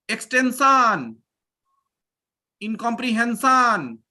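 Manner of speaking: put-on extension and incomprehension said slowly with an Indian accent; Indian pronunciation. Teacher mimicking the Indian way of saying words, in an Indian accent. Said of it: "Typical Indian, Indianized version".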